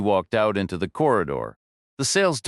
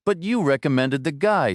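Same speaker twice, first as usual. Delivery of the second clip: raised protest; 6 semitones higher than usual. A narrator's reading. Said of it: "Stone yelled".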